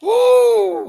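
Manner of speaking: loud, very high woo; excited whoop. Real, not acted. Reacting to the show, a loud high whoop. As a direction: let out a big excited whoop.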